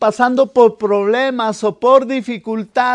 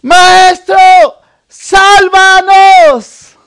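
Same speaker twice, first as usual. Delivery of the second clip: loud, slow cry for help, much higher; desperate cry; 9 semitones higher, 14 dB louder, much slower than usual. Retold or imitated. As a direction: scream it as the boat tips over. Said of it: "y entonces grita".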